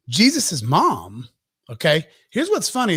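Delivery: normal talking voice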